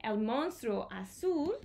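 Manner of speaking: usual speaking voice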